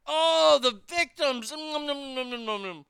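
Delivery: high, sarcastic coo over the victims; mock sympathy, dripping scorn; sarcastic cooing hum; mocking pity. Mocking society's love of victims.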